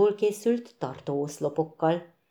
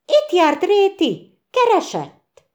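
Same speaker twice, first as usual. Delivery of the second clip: high, slow, excited questioning; eager question; 13 semitones higher, 7 dB louder, slower than usual. A narrator's reading. Tücsök to Bréki, eagerly asking about Réti. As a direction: press for news, thrilled.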